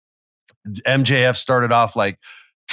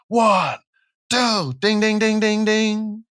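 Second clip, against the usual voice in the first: high fast excited count into bell; 11 semitones higher, slower than usual. Retold or imitated. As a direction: call it like the bell rings. Imitating ref count and bell.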